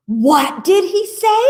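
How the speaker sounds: slow, high, loud disbelief; drawn-out incredulous question; astonished cry. A narrator's reading. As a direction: cry it out as baffled frogs. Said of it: "cried the frogs".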